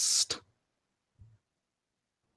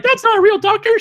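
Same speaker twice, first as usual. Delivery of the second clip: very high, loud, fast squeaky cry; high squealing Mister Bill; 5 dB louder, faster than usual. Retold or imitated. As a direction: wail it like a tiny clay victim. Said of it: "the Mister Bill voice".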